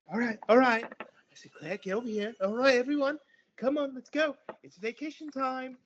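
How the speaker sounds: high, loud bustling mother; cheery otter mom. Acted. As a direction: round everyone up with forced cheer. GM voices the mother.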